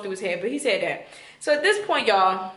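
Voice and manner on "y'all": ordinary talk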